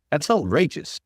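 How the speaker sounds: usual speaking voice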